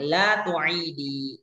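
La tu'idi drawn out very slowly, syllable by syllable; slow model form. Shown as a demonstration. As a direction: stretch La tu'idi slowly so students hear the final i. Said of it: "teacher models feminine form". (La tu'idi diucapkan sangat pelan dan panjang)

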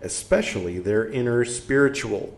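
measured, projected reading voice; emphatic phrase-by-phrase reading; book read-aloud. Acted. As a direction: read the passage out clearly and deliberately.

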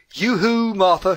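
high, loud sing-song yoo-hoo across the garden; calling out. A narrator's reading. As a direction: call out gaily to a friend at her door. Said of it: "she called".

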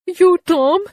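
high, fast, frightened question; gasped out. A narrator's reading. Aunt Polly at the bedside, frightened and high. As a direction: gasp it out in alarm. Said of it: "she gasped out".

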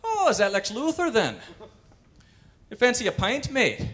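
mock Irish pub friendliness; Irish accent. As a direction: offer a pint in a jolly Irish accent. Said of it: "Rocket could have landed in Ireland".

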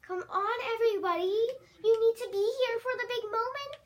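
loud, high call to everyone; yelling across the room. Acted. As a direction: shout for everyone to gather. Summoning everyone to the cake.

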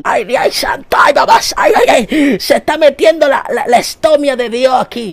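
shrill, high, loud preacher wailing; frantic preacher. Retold or imitated. Comedian mocks a frenzied preacher, high and loud. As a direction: wail it like a preacher possessed. Mocking a preacher's tongues outburst.

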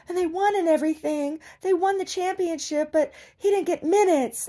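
mock tearful team mom wailing; mock crying mother. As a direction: ham up her sobbing over her son. Still mocking the mother.

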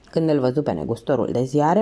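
ordinary delivery

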